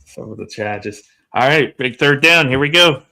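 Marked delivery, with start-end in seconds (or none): 0.0-1.0 playful Boston accent imitation. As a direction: echo it in a mock Boston accent. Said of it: "my Boston accent showing through".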